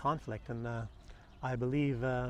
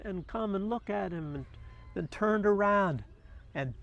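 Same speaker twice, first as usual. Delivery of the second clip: lively story narration pitched higher than his chat; storytelling voice; 4 semitones higher than usual. Acted. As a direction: tell it like a fable to an audience. Tells the little bird story.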